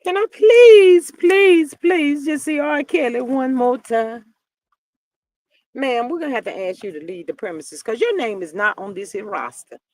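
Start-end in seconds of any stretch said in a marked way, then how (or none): 0.0-4.2 loud, slow high-pitched pleading; desperate drawn-out plea; begging. Pretend. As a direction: beg at the top of your voice, drawn out. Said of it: "I'ma tell them".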